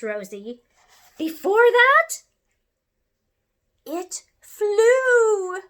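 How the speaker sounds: aunt showing Rosie the crash was a success; elated great-aunt. Acted. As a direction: proclaim it with joyful pride. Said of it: "said her great aunt".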